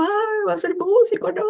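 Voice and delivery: high mock lament, voice thrown up in distress; imitating mother's wail. Mother lamenting her daughter becoming a musician, mock wail. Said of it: "Mi mamá llorando".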